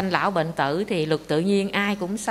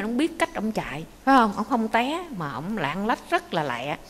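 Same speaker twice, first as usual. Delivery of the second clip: ordinary talk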